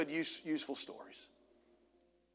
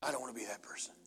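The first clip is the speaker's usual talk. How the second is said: soft unvoiced whisper; whispered confession; 5 semitones lower, 12 dB quieter, faster than usual. Real, not acted. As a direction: confide it under your breath. Preacher's personal confession.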